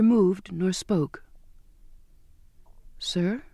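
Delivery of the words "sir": tentative. A narrator's reading. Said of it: "I tried".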